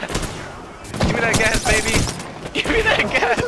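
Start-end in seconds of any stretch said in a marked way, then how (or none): 2.5-3.4 high excited taunt; gleeful shout. Real, not acted. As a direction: crow it with glee.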